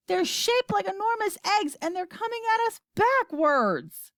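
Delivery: high, frantic report; shouting. A narrator's reading. Astronaut describing the attackers by radio, shouting. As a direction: call it out with pitch way up. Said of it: "shouted Shanks".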